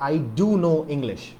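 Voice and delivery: I do know English with a quick hard push on do; stress on do, fast. Shown as a demonstration. Teacher modelling the emphatic answer, quick stress on do. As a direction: punch do to insist. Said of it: "because we want to emphasize it there".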